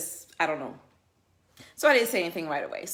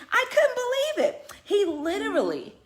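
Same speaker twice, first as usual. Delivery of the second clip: high, slow, laughing through the words; can't believe it, still cracking up; laughing disbelief; 11 semitones higher, 4 dB louder than usual. Real, not acted. Vlogger to her viewers, laughing in disbelief.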